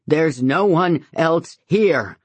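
slow, loud, anguished scream. A narrator's reading. Grieving father screaming at the group, slow and loud. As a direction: scream it out in grief. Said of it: "James screams".

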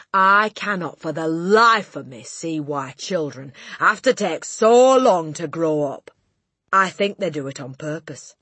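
loud, exasperated rant; Trunchbull. A narrator's reading. Headmistress ranting to the class about children, loud exasperation. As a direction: complain forcefully, leaning on the words.

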